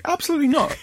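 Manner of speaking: high, insistent no way; absolutely not, said high and firm; emphatic refusal. Real, not acted. Refusing a snake near him.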